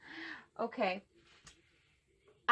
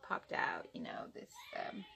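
whisper; 6 dB quieter than usual. Real, not acted. Secret whisper aside.

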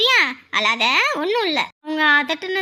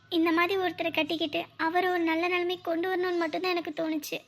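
quiet sincere confession; 6 dB quieter than usual. Acted. Woman telling her suitor why she chose him, softly sincere. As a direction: open up gently in a hushed voice.

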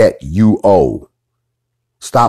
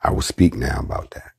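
whispered; 6 semitones lower, 7 dB quieter than usual. Real, not acted. Hushed aside before teaching.

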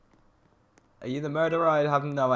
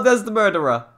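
ordinary talk

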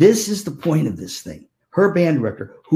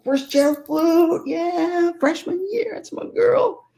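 high, slow, swooning pride; proud gush; slower than usual. Retold or imitated. Aunt boasting of niece's first chair, high proud gush.

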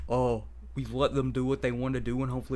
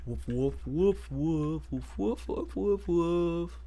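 quick string of pretend barks, climbing higher; imitated barking, rising in pitch; playful dog woofs; 4 semitones higher, slower than usual. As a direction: woof away like a small excited dog.

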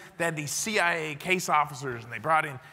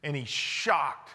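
ordinary talk, nothing marked

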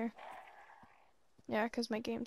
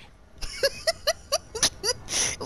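long high-pitched giggling fit; high cackling laugh; 5 dB louder than usual. Real, not acted. Player amused by kicking people off bridges, high cackle. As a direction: cackle high in quick pulses.

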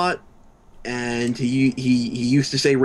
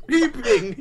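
laughing; 12 semitones higher than usual. Real, not acted. Laughing after the blast.

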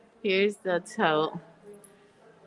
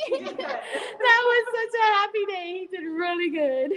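laughing through the words, climbing very high; delighted laughing; 13 semitones higher than usual. Real, not acted. Wife recalls her anniversary gift from her husband, laughing with delight.

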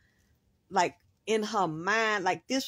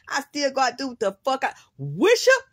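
ordinary delivery

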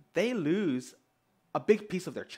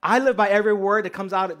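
louder, higher gushing caricature; mock devoted follower; 5 semitones higher, 9 dB louder than usual. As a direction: proclaim it like an overzealous admirer. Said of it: "where people said".

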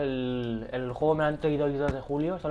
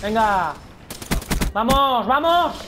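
loud, rising shout of vamos; excited cheer; 4 semitones higher, 5 dB louder, much slower than usual. Real, not acted. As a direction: yell at the screen to push on. Cheering himself on mid-game.

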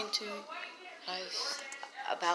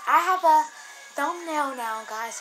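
excited; 8 semitones higher, 7 dB louder, slower than usual. Real, not acted. Excited announcement to viewers.

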